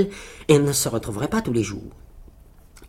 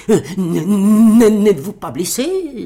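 stuttering, higher-pitched anxious question; stammering riders; 7 semitones higher, 8 dB louder, slower than usual. Acted. Riders ask the hero if he is hurt, stammering. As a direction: stumble hard on the first word.

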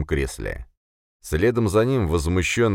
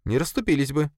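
much higher female-character voice; Sofia, contradicting; 11 semitones higher than usual. Acted. Sofia contradicts her boss, objecting. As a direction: contradict him in a high, even voice.

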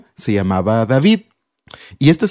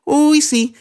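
higher, sneering jeer; bully mocking Pedrito's dream; mocking tease; 10 semitones higher, slower than usual. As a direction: laugh at the kid's dream. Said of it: "les dicen".

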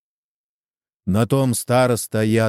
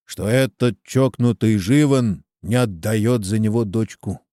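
usual speaking voice

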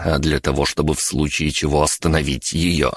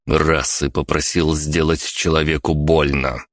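usual speaking voice

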